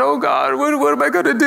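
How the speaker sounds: high, far hammed-up fretting; mock panic. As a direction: play the frightened husband mockingly. Mock panicked non-believer.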